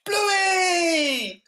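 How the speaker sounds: very high crying shout; anguished cry. Acted. Player crying out as her bear turns, high anguished shout. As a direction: wail the bear's name in despair.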